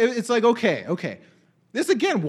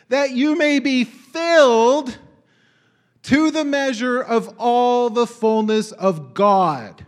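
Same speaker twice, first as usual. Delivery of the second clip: slow, higher and louder, building to a peak; rising preached verse; 3 semitones higher, 6 dB louder, much slower than usual. Acted. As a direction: lift the verse up like a proclamation.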